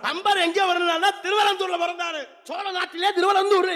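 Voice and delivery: shouting the answer like a schoolboy reciting; loud rote recitation. Acted. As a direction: bellow it out proudly, rote-learned.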